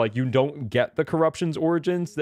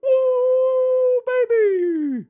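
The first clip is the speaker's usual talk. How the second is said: excited cheer; 11 semitones higher, much slower than usual. Real, not acted. Celebrating collecting all flowers.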